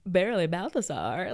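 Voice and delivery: a hint of lovesick drama; mock swooning Romeo. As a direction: play it dreamy and theatrical. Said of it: "Why would you say it like that?".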